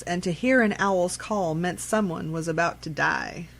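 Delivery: ordinary delivery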